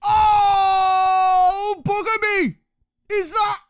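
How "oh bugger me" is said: long, loud, high-pitched "oh"; dismayed wail. Real, not acted. Player reacts to disastrous game outcome, dismayed wail. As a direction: draw out the groan into a loud wail.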